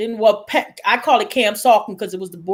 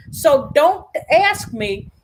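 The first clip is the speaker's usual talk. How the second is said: ordinary talk, nothing marked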